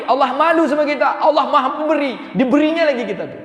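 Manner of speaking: loud, high, ringing sermon voice; full-force preaching. Acted. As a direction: lift the hall with joy about God's mercy.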